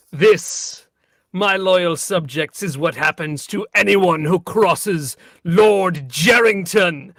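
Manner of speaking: slow, loud royal proclamation; a lord declaring to his subjects; warm regal boom. Acted. King addresses subjects over corpse.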